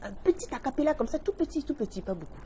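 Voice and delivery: small, high-pitched pleading voice; coaxing. Real, not acted. Host asks the guest for a tiny song, coaxing.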